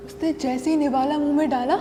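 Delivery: usual speaking voice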